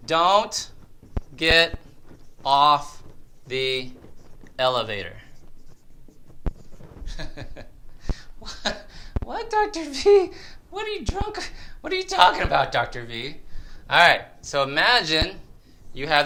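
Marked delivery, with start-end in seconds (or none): 0.1-5.2 slow, with a pause after every word; deliberate, separated words. Real, not acted. Announcing tip number two. 8.4-13.4 high-pitched mock confusion; put-on high voice, disbelieving; baffled patient voice. Voices a baffled patient questioning him.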